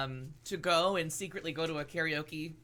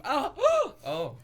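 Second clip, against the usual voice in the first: surprised gasp; 10 semitones higher, 7 dB louder than usual. Real, not acted. Discovers the gift.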